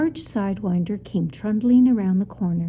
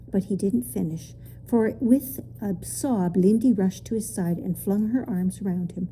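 ordinary delivery

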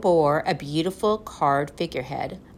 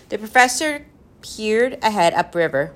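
plain reading voice

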